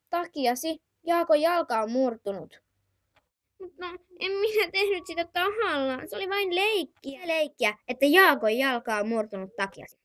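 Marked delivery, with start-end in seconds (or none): 3.6-7.3 put-on high, young child's voice calling auntie; little child asking auntie. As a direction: play a small whiny kid. Child figure defends itself.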